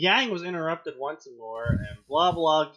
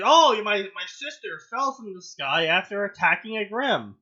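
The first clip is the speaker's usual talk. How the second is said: a touch higher, louder mock gasp; mock Yang voice; 6 semitones higher, 6 dB louder than usual. Friend mocking Yang's surprise, high mock gasp. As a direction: lightly ham up her shock.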